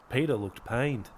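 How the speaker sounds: ordinary delivery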